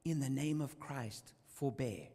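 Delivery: slow, low, fading dying words; a martyr's final words before he dies, weak and fading; dying last plea. Acted. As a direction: let the last breath carry the plea. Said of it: "he cried out one last time".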